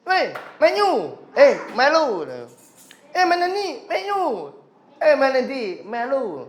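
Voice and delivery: muffled nasal voice, lightly done; teacher imitating a schoolfriend's nasal speech; nasal speech imitation. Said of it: "ngomongnya bindeng itu".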